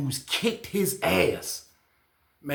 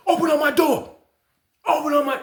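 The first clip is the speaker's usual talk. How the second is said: high, loud demand to open the door; demanding call; 12 semitones higher, 6 dB louder than usual. Retold or imitated. Inmate demanding the officer open his door, loud call.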